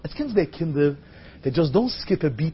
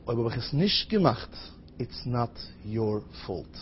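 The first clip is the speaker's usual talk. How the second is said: hushed reassurance; 6 semitones lower, 10 dB quieter than usual. Acted. Patient absolving the surgeon beforehand, low hushed voice. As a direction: let the words drop gently, one by one. Patient's solemn request to the doctor.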